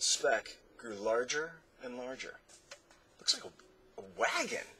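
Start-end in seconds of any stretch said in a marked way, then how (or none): 3.2-4.7 put-on high, eager boy spotting something; excitedly. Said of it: "cried Milo excitedly".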